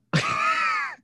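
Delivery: high shrieking burst of laughter. Real, not acted. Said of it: "How are you keeping a straight face".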